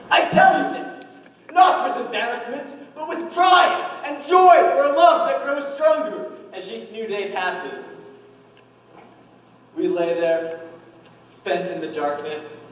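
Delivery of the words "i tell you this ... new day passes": loud, high, swelling with pride; impassioned declaration. Acted. Husband declaring his love to the police, impassioned. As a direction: proclaim your love fervently.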